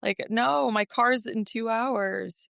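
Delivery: high-pitched, pleading protest; retold disbelief, voice lifted high; desperate denial. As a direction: protest it, refusing to accept it. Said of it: "just wanting to will it away".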